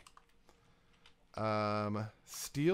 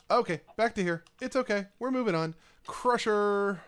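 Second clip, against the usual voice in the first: forced cheer; 8 semitones higher, 6 dB louder than usual. Real, not acted. Cheering self up after loss.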